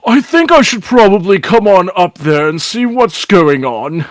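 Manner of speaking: high-voiced, doubtful announcement; suspicious Father. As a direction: call up as the curious Father.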